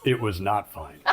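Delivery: dry, low documentary narrator aside; deadpan storyteller voice-over. Acted. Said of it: "Narrator voice".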